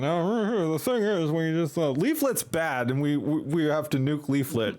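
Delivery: put-on British accent. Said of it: "then talking in a British accent".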